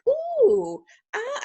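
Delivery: high, exaggerated ooh and ah; storybook reading. Shown as a demonstration. Teacher showing picture-book reading she won't do, exaggerated storybook wonder. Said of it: "I won't be doing storybook like".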